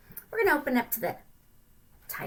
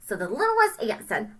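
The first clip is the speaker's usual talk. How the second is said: ordinary delivery, nothing marked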